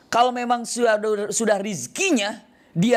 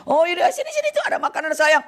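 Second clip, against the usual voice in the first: much higher, inviting voice; friendly traveler; 11 semitones higher than usual. Acted. Traveler invites the hungry student to share food, high friendly call. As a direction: call him over kindly, pitched up.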